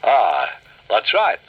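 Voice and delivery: slow, lightly voiced easy dragon. Dragon agrees with the stones, slow. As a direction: drawl it agreeably. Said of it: "said the dragon".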